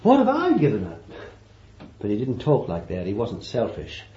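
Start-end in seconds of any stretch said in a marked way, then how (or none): 0.0-1.0 high, fast, huffy "What have I given up?"; a hammed-up complaining disciple; mock indignant boast. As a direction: sneer it like a self-pitying martyr. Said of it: "he could have said for you".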